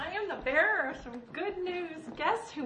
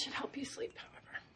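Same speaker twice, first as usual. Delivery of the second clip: whispered; 10 dB quieter than usual. Acted. Whispered aside.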